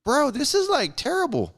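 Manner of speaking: disgusted disbelief to a coworker; incredulous complaint. Retold or imitated. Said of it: "I'm like".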